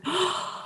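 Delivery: soft, high gasp of awe; children's gasp. Retold or imitated. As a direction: imitate children gasping, rapt. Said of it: "They're like".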